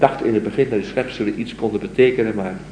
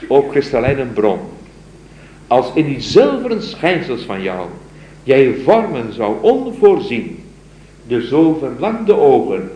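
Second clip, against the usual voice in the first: poem recital; 2 semitones higher, 5 dB louder than usual. Acted. Lecturer reciting the mystic's poem to his students, solemn recital. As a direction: recite it like a devoted lover's plea. Recites crystal fountain stanza.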